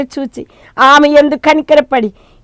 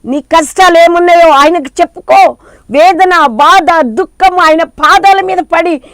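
louder, higher sermon cry; fervent preaching; 5 semitones higher, 6 dB louder than usual. Acted. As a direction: preach it with full fervour to the hall. Preaching at full force. (ఉత్సాహంగా బిగ్గరగా ప్రసంగం)

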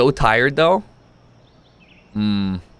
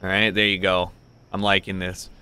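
normal talking voice

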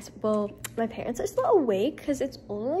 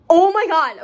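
loud, higher exclamation; excited outburst; 6 semitones higher, 12 dB louder than usual. Real, not acted. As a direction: burst out with sudden excitement. Sudden exclamation mid-chat.

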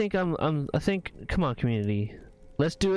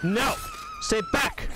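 heroic shout. Pretend.

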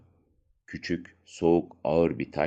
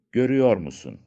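excited question; 6 semitones higher, 6 dB louder than usual. Acted. Boy excitedly shows the colour.

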